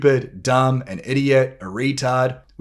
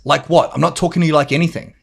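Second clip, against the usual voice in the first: higher, louder, rapid denial; defensive retort; 4 semitones higher, 4 dB louder, faster than usual. Retold or imitated. Husband answers his wife's complaint, defensive and irritated. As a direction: fire back as if wrongly accused. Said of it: "You can hear the tonality".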